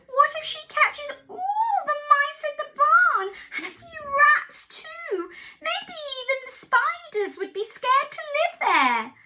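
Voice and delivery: anxious farm cat. Acted. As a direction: squeak it out as a worried cat. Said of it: "said Cat".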